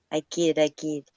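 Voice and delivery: normal talking voice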